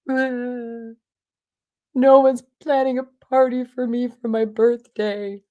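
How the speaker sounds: high, loud whining complaint; whiny younger self. Retold or imitated. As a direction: whine it like a sulky twenty-nine-year-old. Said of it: "and I'm whiny".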